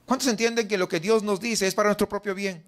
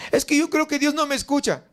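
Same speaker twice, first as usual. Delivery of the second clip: complaining believer; 6 semitones higher than usual. Retold or imitated. Voicing a doubting believer's complaint.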